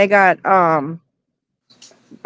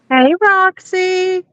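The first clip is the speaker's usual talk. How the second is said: high, slow sing-out to a pet; greeting a dog; 9 semitones higher, much slower than usual. Real, not acted. Friend saying hello to the dog, high pet voice. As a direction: call it out high and drawn out.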